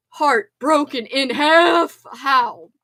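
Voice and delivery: slow, loud, drawn-out lament; mock heartbreak. Real, not acted. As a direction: play up the heartbreak, slowly. Mock heartbreak after the news.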